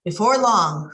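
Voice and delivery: ordinary delivery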